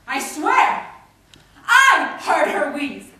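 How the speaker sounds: high, very loud testimony; impassioned shout. Acted. Poet swearing to listeners that she was heard, impassioned shout. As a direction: build to a full-voiced cry on each phrase.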